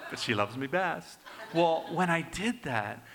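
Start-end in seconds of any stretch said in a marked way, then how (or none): 0.1-1.2 playful boast with a tap on the shoulder; teasing his wife that he's the favourite; teasing brag. Retold or imitated. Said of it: "I tap her on the shoulder, like".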